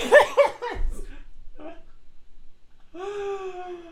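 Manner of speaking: high-pitched giggling burst; high embarrassed laugh. Real, not acted. Streamer caught by a chat reference, laughing high.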